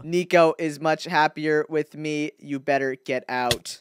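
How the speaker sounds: low, slow, soft menace; low, deliberate fake-human voice; skeleton impostor. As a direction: warn a rival off in a stilted monotone. Impostor clone's voice.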